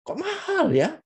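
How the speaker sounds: very high, astonished exclamation; demonstrated amazement at a steep price; surprised intonation. Shown as a demonstration. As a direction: let the pitch leap up in shock. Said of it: "itu intonasinya gitu".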